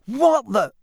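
high, loud burst of shock; startled gasp. A narrator's reading. Ron springing to his feet at the apparition, gasped in shock. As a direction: blurt it out in alarm. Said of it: "gasped Ron".

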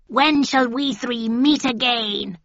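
slow, high cackling witch. A narrator's reading. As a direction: drawl it like an old witch. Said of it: "said the first witch".

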